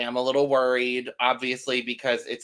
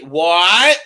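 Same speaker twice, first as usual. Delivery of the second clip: loud rising disbelief; surprised; 4 semitones higher, 10 dB louder than usual. Real, not acted. Host reacting to the edited clip, loud and incredulous. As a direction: toss it up in shock.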